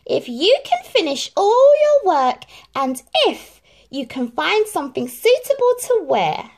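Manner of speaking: high, loud, sly condition; sly stepmother. A narrator's reading. Stepmother sets Cinderella a sly condition. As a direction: set the trap sweetly. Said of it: "said the stepmother slyly".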